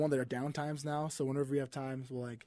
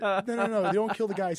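high, loud mock-panicked correction; flustered backpedalling; 6 semitones higher, 7 dB louder than usual. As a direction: hurriedly correct a child, higher and louder. Retelling his flustered correction of child.

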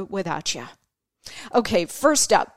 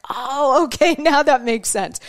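higher, louder imitated 'aha' reaction; retold sudden realization; 5 semitones higher, 5 dB louder than usual. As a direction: act out the penny dropping. Woman's retold mortified realization.

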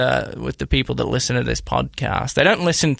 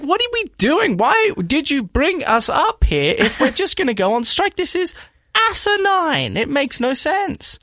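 much higher, incredulous rant; retold exasperation; 12 semitones higher than usual. Telling the cohost about the strike, high exasperated rant. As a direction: throw up your hands, high and exasperated.

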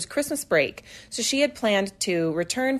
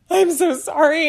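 higher, louder, slow tearful apology; 6 semitones higher, 6 dB louder than usual. Retold or imitated. Young woman apologizing to the whole family, tearful and slow. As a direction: plead it out as she starts to cry. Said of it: "all she does is say".

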